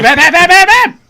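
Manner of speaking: high, loud yelling bad; shouting. Shown as a demonstration. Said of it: "but only say".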